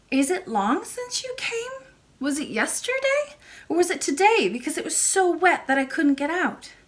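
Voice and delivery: put-on very high little-girl voice; curious child princess. A narrator's reading. Princess asks when the lady came, curious and childlike. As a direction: pipe up as an inquisitive young princess.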